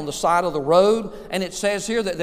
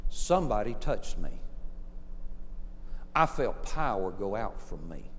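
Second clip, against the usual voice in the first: low, soft, halting words; quiet statement; 8 semitones lower, 7 dB quieter than usual. Retold or imitated. Jesus stopping in the crowd, quiet statement. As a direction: say it quietly, with weight.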